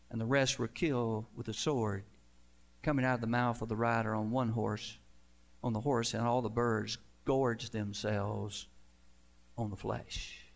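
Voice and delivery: ordinary delivery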